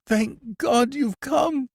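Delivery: high, slow, desperate relief; panicked prisoner greeting his rescuer; terrified exclamation. A narrator's reading. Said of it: "exclaimed the prisoner".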